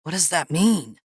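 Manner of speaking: anxious, uncertain question; a touch of fear; nervous stammer. A narrator's reading. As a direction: ask as if dreading the answer. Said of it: "Harvey stammered".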